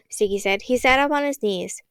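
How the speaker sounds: usual speaking voice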